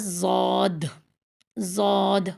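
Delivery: زود with one heavy dark vowel, no w glide; correct Daad. Shown as a demonstration. Teacher introduces the next letter, heavy drawn-out زود. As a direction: hold a heavy, emphatic ض in زود. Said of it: "یہ ہے".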